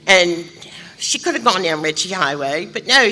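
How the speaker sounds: usual speaking voice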